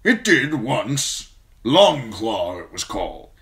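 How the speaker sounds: put-on a gruff old commander praising a young man; gruff old lord commander. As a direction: speak as the stern old Lord Commander, grateful but brusque. Mormont names the sword.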